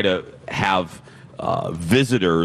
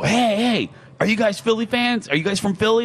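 higher, eager call to strangers; 12 semitones higher than usual. Retold or imitated. Host retelling how she called to Eagles fans, eager call. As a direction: hail the passing fans. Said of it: "And I go".

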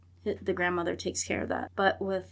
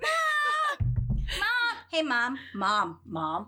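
put-on kids calling Mom; 4 semitones higher, slower than usual.

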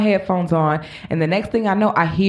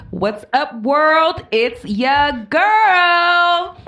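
slow, stretched-out hype intro voice; drawn-out intro; 9 semitones higher, 3 dB louder, much slower than usual. Pretend. Host opening the show, slow hyped drawl. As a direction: drag out it's ya girl.

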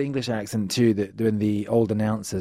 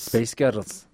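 attempted Scottish accent; slower than usual. Shown as a demonstration. Guest copies the host's trick, attempted Scottish accent. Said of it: "you just say Space Ghettos".

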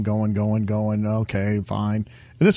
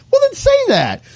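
much higher, fed-up demand; exasperated. Real, not acted. Telling Mike how staff should have talked, exasperated and high. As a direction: toss it off, fed up with them. Complaining about alarming staff.

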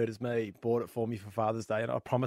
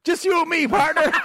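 cowboy punchline; 8 dB louder than usual. Acted. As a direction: land the punchline with a sly cowboy croon. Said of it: "The cowboy goes".

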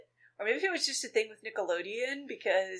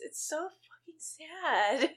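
sympathetic wail; 8 semitones higher, much slower than usual. Real, not acted. Reacting to heartbreaking scene.

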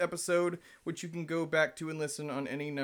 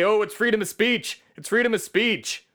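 higher mock protest; mocking quote; 9 semitones higher, 10 dB louder than usual. America quotes the bigots' excuse, mocking. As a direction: parrot their excuse mockingly.

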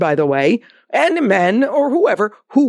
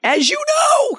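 high exclamation; slower than usual. Real, not acted. Host answering a letter with her guest, high emphatic exclamation. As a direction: sing out each word high.

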